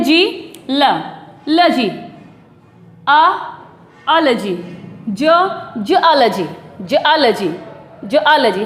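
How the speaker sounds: "जियोलॉजी" piece by piece up to juh-AA-luh-jee; backward syllable drill. Shown as a demonstration. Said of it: "इसको भी ऐसे बोल सकते हैं".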